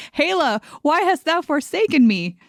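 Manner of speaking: high, hammed-up despairing cry; mock lament. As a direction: wail it to the heavens like a forsaken cleric. Cleric laments failed roll.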